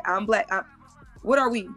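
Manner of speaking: ordinary talk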